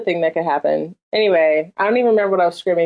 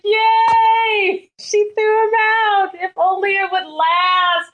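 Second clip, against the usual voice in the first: mock cheer; 11 semitones higher, much slower than usual. Cheering as a delighted viewer.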